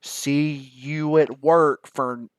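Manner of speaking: slow, loud, over-mouthed words; exaggerated lip movement. Shown as a demonstration. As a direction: mouth every word big for a lip reader. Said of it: "really have to exaggerate it on my lips".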